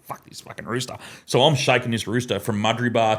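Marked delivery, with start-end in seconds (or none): 0.0-0.9 unvoiced whisper, under the breath; mischievous kid whispering to himself; whispered aside. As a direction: hiss it so the adults won't hear. Kid whispering while shaking box.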